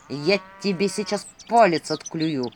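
slow, squeezed owl threat; jealous owl's drawn-out grumble; jealous indignation. A narrator's reading. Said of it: "возмутился Филин ревнивым голосом".

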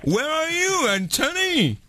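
put-on high-pitched singsong English with a French accent; anglais scolaire moqueur. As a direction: call out high in hammed-up schoolroom English. Mock English call to the caller. (anglais haut perché, accent français exagéré)

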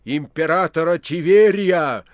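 Pilate's broken voice grows into a shout; swelling hoarse outcry. A narrator's reading. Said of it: "Сорванный и больной голос Пилата разросся".

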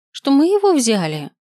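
high-pitched indignant question; indignant flare-up. A narrator's reading. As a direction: throw the accusation back, pitch jumping up. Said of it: "Ротана взвилась мгновенно". (возмущённо, на повышенных тонах)

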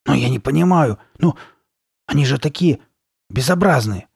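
upset, bewildered outburst; blurting out. A narrator's reading. Girl objecting to the ugly faces, blurting it out. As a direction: blurt it out in disbelief. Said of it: "выпалила Карли Бет".

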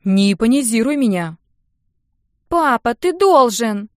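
put-on loud, insistent whiny girl; loud pleading nag; whining demand. A narrator's reading. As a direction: press him with a drawn-out whine. Girl whining demands at her father.

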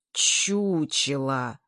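very slow, drawn-out hum; gloomy drone. A narrator's reading. Komarovsky grumbles back at the crowd, slow drone. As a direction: boom it out long and slow. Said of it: "загудел Комаровский".

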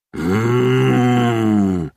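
long closed-mouth moan; drawn-out agonised hum; pained groan. Acted. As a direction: groan as if the head is splitting. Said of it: "мычит Хайд".

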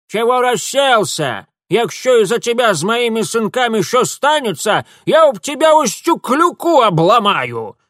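high, loud dialect scolding; feisty old village woman, threatening. Acted. Old woman threatens Mitya over her sons, loud scolding. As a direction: threaten noisily as a fierce granny.

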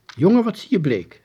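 a caring mum asking at breakfast; mother fussing over her pale son at breakfast; concerned mother. A narrator's reading.

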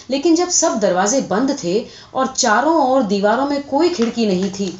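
ordinary delivery, nothing marked

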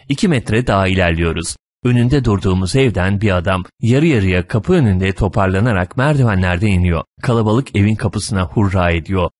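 plain reading voice

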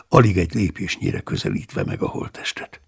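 plain reading voice

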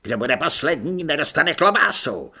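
loud drill-sergeant commands; barked orders. Acted. Corporal Řemendr bawling orders at recruits, loud. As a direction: bark orders at the whole room.

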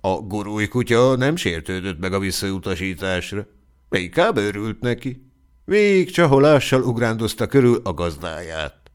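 usual speaking voice

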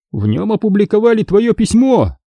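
plain reading voice

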